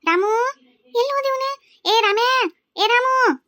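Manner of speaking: high, loud, stretched-out holler; calling out. Acted. Woman hunts for the missing boy, calling out. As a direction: shout the name across the yard.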